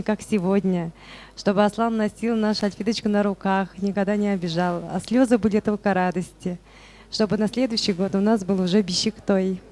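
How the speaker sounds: ordinary talk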